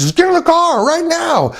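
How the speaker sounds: high-pitched frantic command; urgent retold yell. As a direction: imitate an urgent father ordering everyone out. Father urgently orders son to car.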